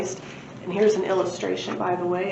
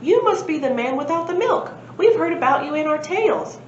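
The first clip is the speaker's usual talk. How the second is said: pony voice; 7 semitones higher, 3 dB louder than usual. A narrator's reading. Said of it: "one of them shouted up at me".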